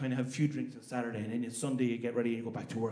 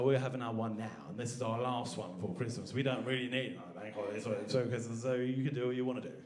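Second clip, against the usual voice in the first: lower, softer, measured English voice; English accent; 4 semitones lower, 5 dB quieter than usual. Acted. English person announcing the last holiday, low English accent. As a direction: explain it quietly in an English accent. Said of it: "the Innish go".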